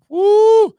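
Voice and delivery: high, loud "woo"; sudden loud, drawn-out whoop. Real, not acted. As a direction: let out a high-pitched whoop. Reacting to big flying bugs.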